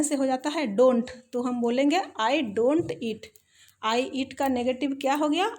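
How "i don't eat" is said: slow dictation. Shown as a demonstration. Teacher dictating the model sentence.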